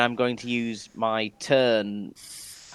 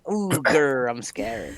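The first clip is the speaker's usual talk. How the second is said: sarcastic mock fear; 5 semitones higher, much slower than usual. Mocking the weak intimidation roll.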